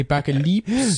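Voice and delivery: put-on slow, oddly accented single word; mocking invented accent; That Sodding Gamer. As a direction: draw it out in the weird made-up accent. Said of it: "this weird accent he made up".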